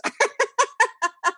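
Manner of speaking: a bright high laugh; high amused laugh. Real, not acted. Teacher laughing at a parent's mishap, high laugh. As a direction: laugh at the familiar mess.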